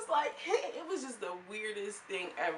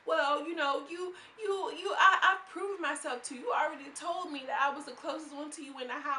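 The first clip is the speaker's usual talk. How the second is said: mock tearful plea; 7 semitones higher, 4 dB louder than usual. Voicing upset Barbie pleading.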